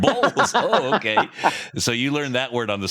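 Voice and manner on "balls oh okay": high, loud startled yelp into a laugh; mock shocked. Dad reacts to his son's rude word, mock shocked. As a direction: fake shock, then laugh it off. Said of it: "I was like".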